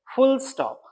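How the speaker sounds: फुल स्टॉप high, s joined to फुल; फुल स्टॉप as fuls-top; correct pronunciation. Shown as a demonstration. Teacher answering his own drill question. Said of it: "क्या pronounce करेंगे".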